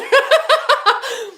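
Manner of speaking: loud, high giggling laugh; quick cackle breaking out; burst of laughter. Real, not acted. Streamer reads about a pill organizer, loud laugh.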